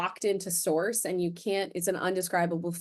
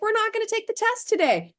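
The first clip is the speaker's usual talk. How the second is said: much higher, chirpy announcement voice; teacher announcing; 4 dB louder than usual. Retold or imitated. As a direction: announce it brightly to the class. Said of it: "they're like".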